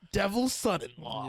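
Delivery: loud, high, drawn-out title reveal; triumphant announcement. Real, not acted. Host revealing next week's read to listeners, triumphant. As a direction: announce it big and slow.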